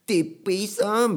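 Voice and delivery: high, put-on anime call; cartoon character voice. Student calling the teacher, high anime-style imitation. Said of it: "キャラクターの立ったね、".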